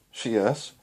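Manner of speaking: ordinary delivery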